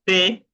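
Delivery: "T" pronounced teh, keeping the E; accented final E. Shown as a demonstration. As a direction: model "T" with a clear stressed e. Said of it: "Porque lleva acento".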